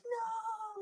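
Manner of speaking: sudden high yelled no; retold scream. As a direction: shout it like a desperate man at the altar. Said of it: "just screaming at the altar".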